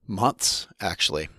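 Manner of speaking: ordinary talk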